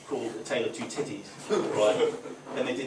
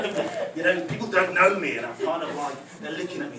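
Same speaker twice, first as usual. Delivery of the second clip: normal talking voice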